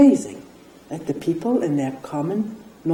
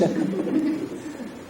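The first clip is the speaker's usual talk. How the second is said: quiet airy laugh, much softer; soft breathy laugh; 10 semitones higher, 6 dB quieter than usual. Real, not acted. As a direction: let a soft laugh slip out at your own joke. Laughs at own mock claim.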